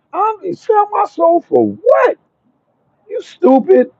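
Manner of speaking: squeaky high mocking voice. Mocking those who sell out.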